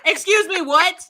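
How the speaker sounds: high, loud indignant excuse me; indignant what. Real, not acted. Friend fuming at the book's ending, loud indignant disbelief. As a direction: demand an answer, outraged.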